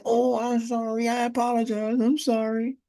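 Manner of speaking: high, put-on voice saying sorry; mock polite apology, pitched high; apologetic youth. Young man apologizing to a stranger's grandmother, high mock voice.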